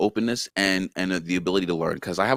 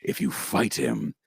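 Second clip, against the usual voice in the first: low, slowed, powerful dim brute; Namda; 7 semitones lower than usual. Shown as a demonstration. Actor demonstrates the not-so-sharp fighter, low and powerful. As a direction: drop the voice deep and speak with power. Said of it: "his voice gets a little lower".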